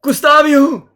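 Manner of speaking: loud, high character shout; calling out. Acted. Artist calls out across the room.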